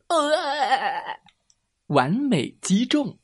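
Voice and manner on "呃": high quavering yelp; villain's hit cry. A narrator's reading. Alien struck by the super beam, high wobbling cry. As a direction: yelp as if blasted away.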